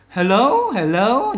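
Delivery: "hello" slow, weight on "lo"; rising, stretched "lo" in "hello"; stress on second syllable. Shown as a demonstration. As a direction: lean on the end of "hello". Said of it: "Nhấn là hello".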